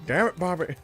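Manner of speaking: lightly imitated exasperated cartoon dad; a hint of boss doing a catchphrase; Hank Hill. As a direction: scold like a Southern cartoon dad, half-seriously.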